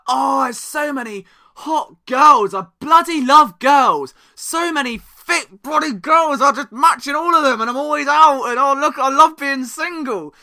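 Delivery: loud, high, drawn-out bragging; mock show-off single. Newly single person flaunting nights out and Tinder, loud mock bragging. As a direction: flaunt it in a loud, stretched-out put-on voice. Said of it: "won't stop trying to flaunt that life and be like".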